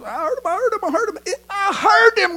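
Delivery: high, repeated insistence; frantic pleading. Acted. Grandson begging grandpa to believe him, frantic pleading. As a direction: plead in a child's high pitch, stretching the last.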